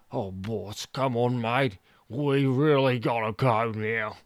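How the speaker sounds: slow, nervous urging; lightly voiced scared cameraman; Bunce pleading. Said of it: "said Bunce".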